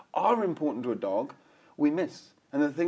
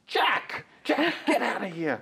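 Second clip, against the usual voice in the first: high mock little boy scolding the dog; five-year-old shouting at the dog, much higher; mock child yelling at dog; 8 semitones higher, slower than usual. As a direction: imitate his son shouting at Jack. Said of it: "every now and then you hear it".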